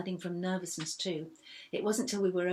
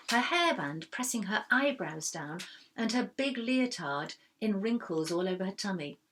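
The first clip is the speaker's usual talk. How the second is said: usual speaking voice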